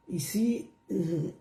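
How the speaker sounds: usual speaking voice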